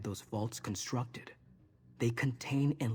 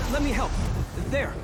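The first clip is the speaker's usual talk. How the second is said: much higher, louder urgent offer; urgent raised call; 13 semitones higher, 7 dB louder than usual. Acted. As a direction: call out as you rush in to help. Rushing in to help.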